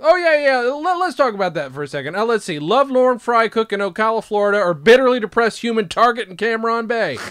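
loud, high, worked-up tirade; acted rant. Host quotes the character's backstory rant, loud and worked-up. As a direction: launch into a mocking rant.

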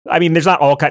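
ordinary talk

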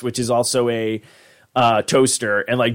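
ordinary talk, nothing marked

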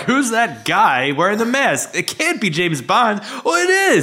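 high-pitched mock moviegoer amazement; mock astonishment. Host teasing co-host as a fooled moviegoer, mock astonished. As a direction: puzzle it out, then leap up high at the reveal.

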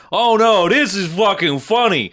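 loud, high-pitched mock insistence; hammed-up brash comedian voice; Andrew Dice Clay. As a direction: crow it like a cocky comic defending his bit. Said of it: "Dice Clay is just like".

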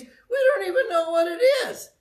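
very high, half-laughing cry; exasperated exclamation. Real, not acted. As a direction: let the voice leap up in disbelief. Exclaims at unknowingly copying peers.

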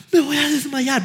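high-pitched imitated panicked wail; child in shock. Son to angry dad, imitated panic in shock. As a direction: act out a boy overwhelmed by panic. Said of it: "llegó a un momento de shock".

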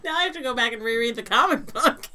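high and loud through laughter; laughing. Real, not acted. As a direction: keep laughing while you say it. Laughing after the joke.

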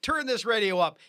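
high, bright show-opening announcer voice; radio host intro. Acted. Host welcoming listeners to the show, upbeat announcer. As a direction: pump up the listeners at the top of the show.

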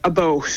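"a boat" for about, oh vowel; "about" nearly rhyming with boat; Canadian "a boat". Shown as a demonstration. Canadian explaining the sound to look for, oh-vowel "a boat". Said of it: "So almost like".

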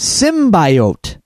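"Symbiote" repeated as SIM-bye-oat; mispronounced symbiote. Retold or imitated. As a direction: quote "Symbiote" with a long I in the middle. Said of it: "then she said it again".

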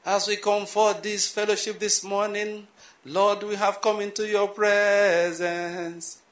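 slow, drawn-out echoing pulpit voice; old churchy prayer tone. Retold or imitated. Preacher mimicking the special tone used to address the Lord, slow and drawn out. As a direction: stretch each word as if filling a hall. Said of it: "Why do people talk like this?".

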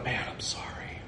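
mock whispered apology. Mock apology Jesus never gave.

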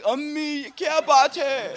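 high, whiny mock sob in Urdu; mock crying. Young man on the phone to his mother-in-law, in mock tears. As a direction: play up a hammed-up tearful plea. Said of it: "I called crying".

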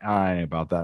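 dismissive dog voice. Retold or imitated.

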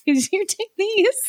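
high, soft mock question; high squeaky voice. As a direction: squeak it out incredulously. Mock quote from the story.

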